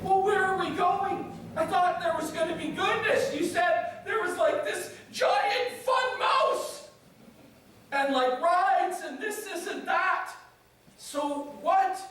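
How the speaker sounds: high-pitched, hammed-up kid whining; complaining child. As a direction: imitate a let-down child, high and drawn out. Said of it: "And the kids are like".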